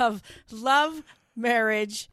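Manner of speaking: loud title read; announcer voice. Acted. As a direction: announce the show name.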